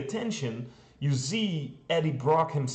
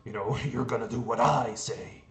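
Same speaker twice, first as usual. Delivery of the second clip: Venom voice; 2 semitones lower than usual. Retold or imitated. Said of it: "Venom could say".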